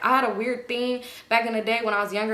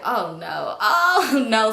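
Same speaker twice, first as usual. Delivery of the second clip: laughing oh no, louder the second time; dying laughing; 4 dB louder, much slower than usual. Retold or imitated. Friend hears she's disliked, dying laughing. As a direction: imitate someone cracking up mid-phrase. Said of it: "she just dying laughing".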